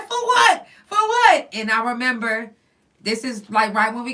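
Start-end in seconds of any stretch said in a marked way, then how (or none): 0.0-1.5 much higher, louder outraged "for what"; imitating her outraged protest; indignant protest. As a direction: throw it back at the guard in disbelief. She protests being cuffed.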